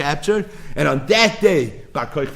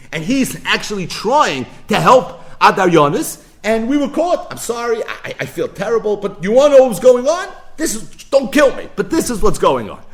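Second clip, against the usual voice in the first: sly informer; 8 semitones higher, 6 dB louder than usual. Retold or imitated. Kuti framing the rabbi, acted.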